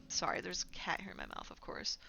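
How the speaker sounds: hushed unvoiced whisper. Real, not acted. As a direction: whisper it off-mic aside. Cat suddenly in her face.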